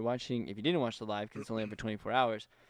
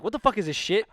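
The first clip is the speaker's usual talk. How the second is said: mom yelling; 9 semitones higher, 7 dB louder than usual. Retold or imitated. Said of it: "And she was like".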